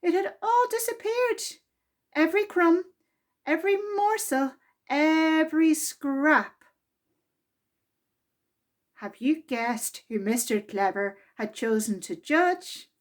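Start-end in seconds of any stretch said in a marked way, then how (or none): none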